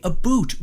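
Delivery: "a boot" for "about"; mock Canadian "about". Shown as a demonstration. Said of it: "the way that Americans think we say".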